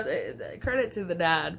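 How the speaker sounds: normal talking voice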